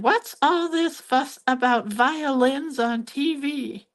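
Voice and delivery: put-on high, slow, fussy old lady voice; Emily Litella impression. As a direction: play the muddled indignant old lady. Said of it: "And she did".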